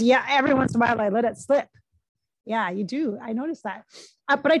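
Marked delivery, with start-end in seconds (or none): 0.0-1.7 put-on quick, loud, light New Jersey accent; hint of imitated New Jersey accent; Ray Liotta. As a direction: toss it off like a New Jersey guy. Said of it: "He has a bit of an accent".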